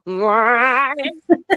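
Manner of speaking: drawn-out rising cat-like yowl; imitated cat-like sound. Recreating the crazy sound heard on the recording, imitated yowl. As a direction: imitate an eerie creature cry, sliding upward. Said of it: "like, that's how it sounds".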